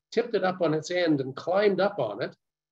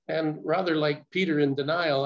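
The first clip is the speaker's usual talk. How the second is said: ordinary delivery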